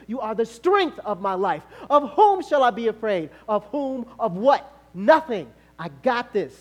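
loud declamatory scripture; full-force preaching. Real, not acted. Preacher proclaiming fearless trust in God, fervent. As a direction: thunder the questions and answer.

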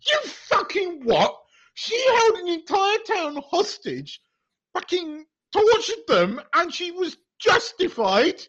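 high, slow incredulous outrage; you can't believe what you heard; outraged disbelief. Real, not acted. Outraged rant at the film.

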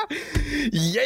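squealing laugh then yeah; high laughing. Real, not acted. Laughs after scolding.

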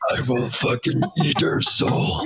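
slow, rasping hushed demon growl. Retold or imitated. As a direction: threaten like a possessed demon. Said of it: "why is there a demon in front of me".

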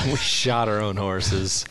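slow, disbelieving echo of "shot"; mock repeating. Friend slowly echoes the horse line in mock disbelief. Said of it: "Did you say you shot your own horses?".

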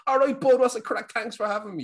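put-on high, loud Irish-accented greeting; playing up his own Irish accent; broad Irish accent. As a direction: burst out in a chirpy, high-pitched Irish accent. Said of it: "didn't even bring out my Irish accent".

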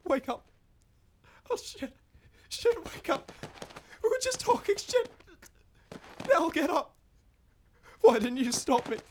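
high, frantic, breaking voice; panicked. Acted. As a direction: panic over what you did. Panicking over the body.

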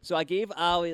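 usual speaking voice

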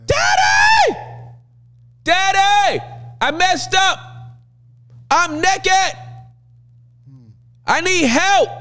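high-pitched yell for Daddy, far above normal talk; hammed-up panicked yelling. Adam streaking through the garden to his Father, yelling in panic. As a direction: cry out for help at the top of your lungs. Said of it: "yelling at the top of his lungs".

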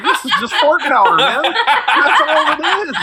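high, laughing through the words; cracking up in disgust, slow at the end. Retold or imitated. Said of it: "And I was like".

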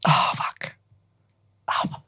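soft swearing under the breath; whispered curse. Real, not acted. Poet hunting for the other poem, curses in a whisper. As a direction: whisper it to yourself. Muttering while searching for the poem.

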